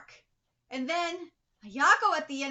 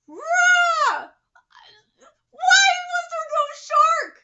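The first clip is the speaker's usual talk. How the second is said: high, loud outraged yell; furious shout; 14 semitones higher, 14 dB louder, much slower than usual. Real, not acted. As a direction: roar it in real disappointment. Angry reaction to the chapter.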